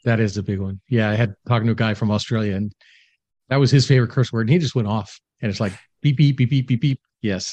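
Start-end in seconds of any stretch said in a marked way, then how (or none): 6.0-7.0 censor bleep imitation. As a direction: beep beep, mimicking a bleep. Mimicking censor bleeps for swearing.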